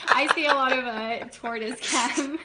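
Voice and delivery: laughing while talking; chuckling, amused at chat; kind gentle laugh. Real, not acted. Amused by chat's turtle requests.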